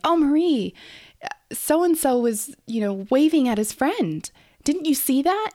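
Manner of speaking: high, bright teacher voice. Retold or imitated. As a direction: voice an excited coworker. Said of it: "be like".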